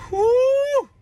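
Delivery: loud, very high drawn-out "whoo"; high whoop. Real, not acted. Storyteller blowing off steam mid-rant, loud high whoop. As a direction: let out one sustained high hoot after laughing. Lets out a whoop after laughing.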